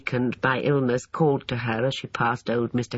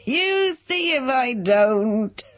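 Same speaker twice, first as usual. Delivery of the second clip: very high, slow cackling crow; high cracked old man's gloat; 10 semitones higher, 4 dB louder, slower than usual. Acted. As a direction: crow in triumph like a gleeful old man. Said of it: "he crowed".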